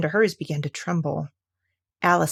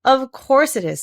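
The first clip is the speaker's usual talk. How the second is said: loud eager Duchess; 6 semitones higher, 6 dB louder than usual. A narrator's reading. Duchess agreeing.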